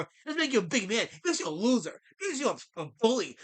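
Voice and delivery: ordinary talk, nothing marked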